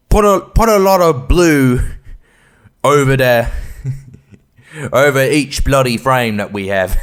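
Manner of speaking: loud, hammed-up British director; bad British accent. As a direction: boom it out in a shaky attempt at British. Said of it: "particular kind of British accent he has".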